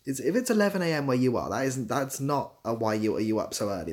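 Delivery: ordinary delivery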